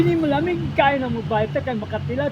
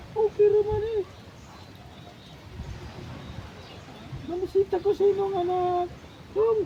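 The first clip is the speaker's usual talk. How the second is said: high-pitched role-play voice; high, put-on visitor voice; 7 semitones higher than usual. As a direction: lift it into falsetto.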